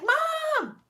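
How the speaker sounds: mock shrieking high call of Mom; shrill kid screech imitated; pterodactyl scream. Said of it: "She's doing the pterodactyl scream".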